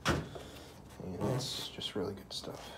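slow, hushed whisper of admiration. Real, not acted. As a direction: breathe it out like a secret, slowly. Admiring the barn wood.

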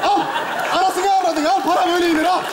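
loud, high, repeated shouts; showy boasting. Pretend. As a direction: shout it showily for the camera. Showing off throwing money for video.

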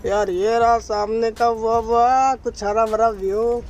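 usual speaking voice

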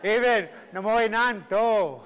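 "Namoy Nanto" drawn out slowly, high and loud; emphatic. Shown as a demonstration. Teacher drilling a Cree response, "Namoy Nanto" stretched with loud emphasis. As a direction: put heavy, slow emphasis on "Namoy Nanto". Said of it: "put some emphasis in it".

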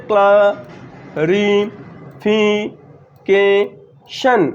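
"Clarification" very slowly in five beats with long gaps; syllable by syllable. Shown as a demonstration. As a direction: isolate and stretch each syllable of "clarification". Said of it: "দেখো কতবার উচ্চারিত হচ্ছে".

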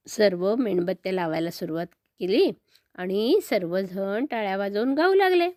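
ordinary delivery, nothing marked

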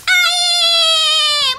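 high loud whoop; triumphant cheer. Real, not acted. Player cheers a win, high whoop. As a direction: whoop at success.

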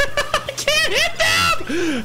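边笑边喊, 音调很高, 拖得很慢; laughing shout. Real, not acted. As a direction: laugh it out loud and high, dragging each word. Frustrated at missing shots.

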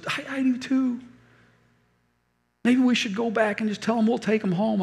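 high, tearful pleading; crying. Retold or imitated. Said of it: "both driving out of the airport crying".

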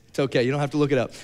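ordinary talk, nothing marked

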